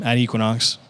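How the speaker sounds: usual speaking voice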